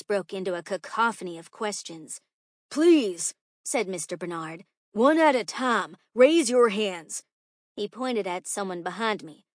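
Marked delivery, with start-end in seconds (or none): 2.7-3.3 calling for order. Acted. Teacher hushes a cacophony of questions, loudly. Teacher quiets noisy class. 4.9-7.2 loud, raised instruction; calling for order. Acted. Teacher manages a cacophony of questions, loud and firm. Teacher quiets noisy class.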